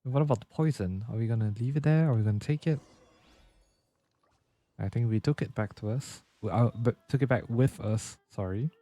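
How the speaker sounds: ordinary delivery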